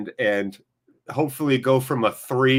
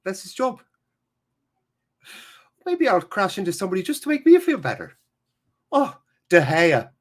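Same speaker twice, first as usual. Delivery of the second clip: high-pitched Irish pundit impression; Roy Keane; 6 semitones higher than usual. Streamer mimics a pundit berating players, high impression. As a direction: put on a high, exasperated pundit's voice. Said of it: "It's very Roy Keane".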